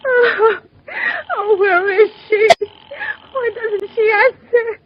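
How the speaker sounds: terrified invalid on the edge of hysteria; panicked wail. Acted. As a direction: wail for the operator to answer. Panic waiting for operator.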